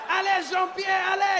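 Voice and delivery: put-on high, shouted French-accented alarm; a French dad spotting the snake; panicked French tourist shouting. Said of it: "The French guy was my favorite".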